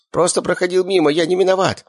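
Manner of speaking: high-pitched feeble excuse; weak captive's self-defence; mumbled excuse. A narrator's reading. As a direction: keep protesting innocence in a high voice. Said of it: "продолжал бубнить под нос Щуплый".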